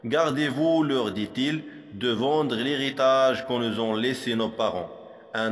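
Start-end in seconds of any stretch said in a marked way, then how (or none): none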